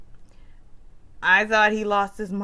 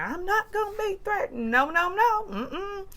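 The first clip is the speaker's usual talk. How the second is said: mock refusal; 12 semitones higher than usual. Sutton refusing, mimicked.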